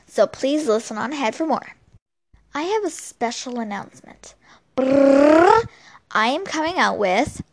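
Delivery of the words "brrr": rolled lips trill, rising; vocal drum roll before the reveal. Pretend. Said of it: "it's my drum roll".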